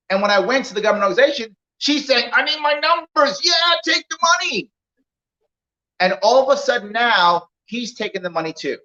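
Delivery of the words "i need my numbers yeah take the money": higher, pressing boss voice; pushy boss. Retold or imitated. Boss tells Larry to take the money anyway, pushy. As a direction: insist on hitting the numbers. Boss demands her numbers.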